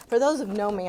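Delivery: ordinary talk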